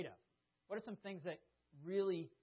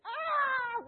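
very high, loud fright scream; acted scream; 14 dB louder than usual. Preacher reliving hitting masked brother, high panicked scream. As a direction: scream as you lash out in panic. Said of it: "I was screaming at the same time".

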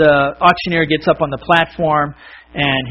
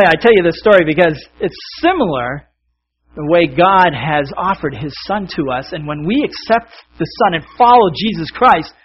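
usual speaking voice

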